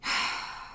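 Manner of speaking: a quiet, breathy sigh of resignation; soft sigh. Acted. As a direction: let the anger out in a soft sigh. Sighs after the outburst.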